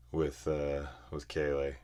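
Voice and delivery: ordinary delivery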